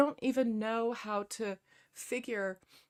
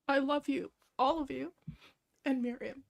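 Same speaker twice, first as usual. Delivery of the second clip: tearful affection; 6 semitones higher than usual. Real, not acted. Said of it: "you're gonna make me cry".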